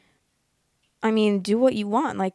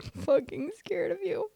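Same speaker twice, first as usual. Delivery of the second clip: laughing; 10 semitones higher, 3 dB quieter than usual. Real, not acted. Reacting to ex-classmate's post.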